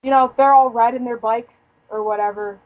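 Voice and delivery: normal talking voice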